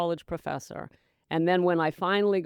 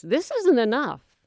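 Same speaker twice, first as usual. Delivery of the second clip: complaining voice; 5 semitones higher, 5 dB louder than usual. Retold or imitated. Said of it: "One says".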